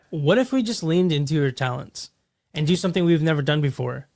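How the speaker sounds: ordinary talk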